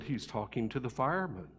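put-on small, hushed child's hello; a timid kid picking up the phone, hushed; quiet little boy. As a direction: answer softly like a shy little boy. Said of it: "real quiet and soft".

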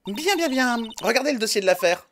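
voix très aiguë de jeune enquêtrice; enthousiasme joué. Acted. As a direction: jubile d'une voix perchée. Enquêtrice ravie d'expliquer. (enquêtrice ravie, ton haut perché)

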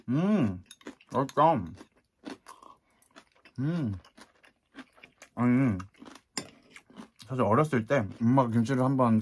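chewing while talking; muffled by food; mouth full. Real, not acted. Seen 1: Bites kimchi at 4.7, chews through 9.7 with full cheeks.

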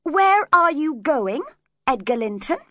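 sharp demand. A narrator's reading. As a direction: demand it as he walks out. Quarrel, he walks out on her.

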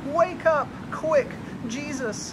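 high, loud, frantic cry; panicked plea. Acted. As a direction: beg him to wake, terrified. Panicked friends wake Jesus.

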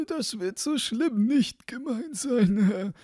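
high, slow character voice; high-voiced, unworried sexton; self-assured sexton. Sexton's thought in his put-on voice.

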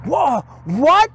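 high, stunned disbelief; shocked producer. Retold or imitated. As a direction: gasp it out like the producer can't believe it. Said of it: "And he goes".

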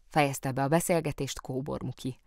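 ordinary delivery, nothing marked

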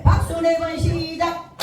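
high, chanted clap cue with a stretched last word; calling the cue. Real, not acted. Instructor starting the group's clapping, high sing-out call. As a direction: call out the count-in on a high held note.

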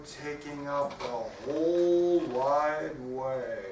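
very slow, drawn-out chant; plodding story narration. A narrator's reading. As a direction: stretch every word like a lumbering cow.

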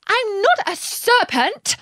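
high, slow, indignant protest. A narrator's reading. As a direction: protest hotly, affronted at the accusation. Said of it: "said Alice indignantly".

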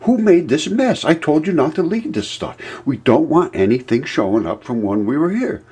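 high, strained, indignant rant; upset boss. Retold or imitated. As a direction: scold him in a tight, upset voice. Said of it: "he's, he's upset".

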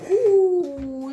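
sudden loud ooh; surprised ooh. Real, not acted. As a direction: blurt it out in surprise. Sudden reaction to the drugs.

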